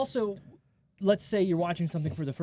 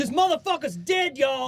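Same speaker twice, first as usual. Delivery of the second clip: high, excited yell; high-pitched comic shout; hollered movie quote; 9 semitones higher than usual. Acted.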